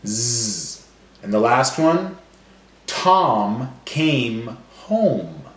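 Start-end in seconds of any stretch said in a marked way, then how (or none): 2.9-5.4 slow, every m drawn out; slow, stretched sentence. Shown as a demonstration. Teacher modelling end sounds slowly to class. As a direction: hold each word long on the m. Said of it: "every word's gonna end with the same sound".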